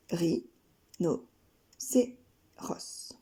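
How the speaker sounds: Ri, no, cé, ros, fading to a whisper; split into syllables. Shown as a demonstration. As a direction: beat out Rhinocéros one syllable at a time. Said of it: "quatre syllabes, quatre arcs de cercle".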